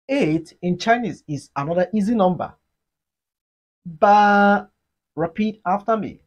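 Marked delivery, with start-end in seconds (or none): 3.8-4.7 "Ba" held long on a level pitch; flat tone. Shown as a demonstration. Teacher models Chinese eight for learners, flat-tone "Ba". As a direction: sustain a firm, unbending "Ba". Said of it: "the flat tone of Chinese".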